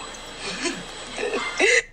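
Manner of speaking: amused laugh rising high; real laugh, climbing in pitch; laughter. Real, not acted. Amused reaction to dad's story.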